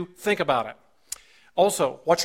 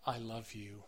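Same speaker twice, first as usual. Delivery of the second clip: tender love you; 6 semitones lower, 16 dB quieter than usual. Shown as a demonstration. Preacher showing spouses a loving tone, tender. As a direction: say it gently and mean it. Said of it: "The tone makes a difference".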